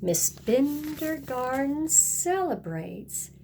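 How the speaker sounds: ordinary delivery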